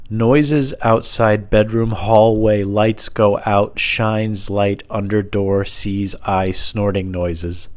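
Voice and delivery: very slow, word by word; drawn-out dramatic recital; deliberate note reading. Pretend. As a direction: read each odd word with puzzled weight. Reading cryptic night note aloud.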